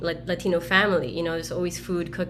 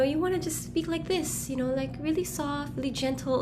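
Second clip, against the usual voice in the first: a higher, softer mother voice; mother coaching softly; 7 semitones higher, 6 dB quieter than usual. Retold or imitated. Daughter imitates her mother urging her to speak softly, high and soft. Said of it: "my mother really had a problem with the sound of my voice".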